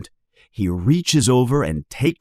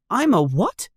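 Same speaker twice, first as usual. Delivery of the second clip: put-on high startled boy; gasp; 12 semitones higher than usual. Harry shocked at being a wizard, high gasp. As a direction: blurt it in disbelief. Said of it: "gasps Harry".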